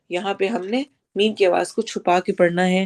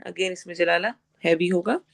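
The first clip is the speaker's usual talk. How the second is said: ordinary talk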